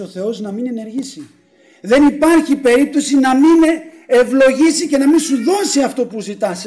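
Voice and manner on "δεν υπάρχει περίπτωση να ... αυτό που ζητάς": loud, high preaching voice; emphatic assurance. Real, not acted. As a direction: assure the congregation with full force. (εμφατική διαβεβαίωση κηρύγματος)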